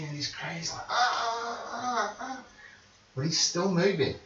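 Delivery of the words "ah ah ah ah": high, thin mock cries of pain; imitating screams. GM imitates the prisoner's screams of agony, high. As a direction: yelp like a man in agony. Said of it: "he's screaming".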